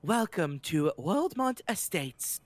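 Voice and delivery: slow, prim British intercom lady; the estate's intercom greets the visitors in a female British accent. Acted. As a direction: read it like a posh English voice on an intercom, slowly. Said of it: "a very female British accent".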